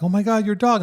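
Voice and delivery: high, loud mimicked gushing; excited women. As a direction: imitate women gushing over a dog. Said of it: "this group of women".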